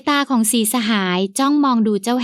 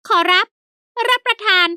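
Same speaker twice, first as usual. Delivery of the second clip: high, eager fawning servant; 8 semitones higher, much slower than usual. A narrator's reading. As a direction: pitch it up as the polite old servant. Servant Haew answers.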